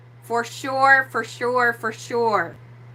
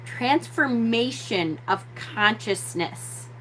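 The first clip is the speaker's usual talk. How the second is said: slow, softer "Transformation of consciousness"; emphatic repeat; 6 dB quieter, slower than usual. Shown as a demonstration. Teacher repeats a key phrase to the audience, slowly and deliberately. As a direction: draw out "Transformation of consciousness" with weight. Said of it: "Let me say that again".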